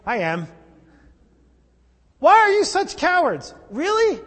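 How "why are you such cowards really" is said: high, loud, incredulous echo; indignant high-pitched mocking quote; annoyed. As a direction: throw it back in exasperated disbelief. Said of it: "Is anybody else annoyed with Jesus".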